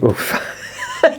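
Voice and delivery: a sudden high, breathy laugh of disbelief; a high, airy laugh escapes; high burst of laughter. Real, not acted. Laughing in disbelief as the dog burps into his beer.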